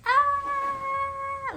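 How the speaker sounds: sharp high scream of pain, reenacted; retold scream. Mother on the operating table feeling the cut, sharp pained scream. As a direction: cry out as the first cut is felt. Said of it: "Like, I screamed".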